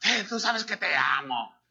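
lightly voiced careless husband; Juan Pistolas. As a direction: toss it off like an insincere husband. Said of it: "Juan Pistolas". (marido descuidado, con desgana fingida)

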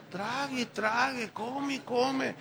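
slow, drawn-out scorn; slow mocking. Real, not acted. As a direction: drag it out to mock their gobbling. Mocking believers who swallow everything.

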